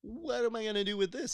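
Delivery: high-pitched, incredulous question; high mock-exasperated protest; retold dismay. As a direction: reenact baffled dismay in a high voice. Retold dismay at gift certificate.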